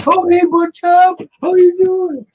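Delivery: very high, loud put-on greeting; imitating a greeter. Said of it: "He's like".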